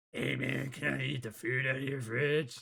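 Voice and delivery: odd put-on moocher voice; creepy freeloader alien. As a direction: play the weird guest begging for food. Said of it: "No creeper aliens allowed".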